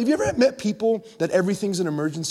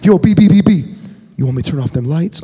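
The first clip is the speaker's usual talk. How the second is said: loud buddy call, then low and fast; hammed-up pal with a trivial request; imitating friend Chris; 3 semitones lower, 7 dB louder than usual. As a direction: call out big, then mumble the ask. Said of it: "It's rarely anything ever".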